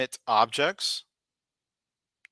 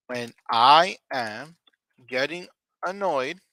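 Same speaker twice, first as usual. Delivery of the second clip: slow model answer; 5 dB louder, slower than usual. Shown as a demonstration. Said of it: "so you would say this".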